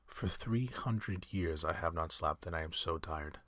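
usual speaking voice

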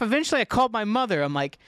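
normal talking voice

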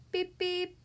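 high, toy-like horn imitation; car horn beep. A narrator's reading. Daddy Pig's car setting off, high imitated beep.

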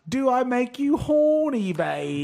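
high, slow, drawn-out catchphrase impression; Austin Powers. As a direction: stretch out the catchphrase in a high put-on voice. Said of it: "I was Austin Powers".

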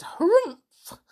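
high, loud horse snort-cry; civil horsey harrumph. A narrator's reading. Dobby greeting Trotter, a civil high horsey harrumph. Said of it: "in a very civil, horsey voice".

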